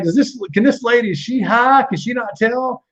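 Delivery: incredulous. Retold or imitated. Inner disbelief.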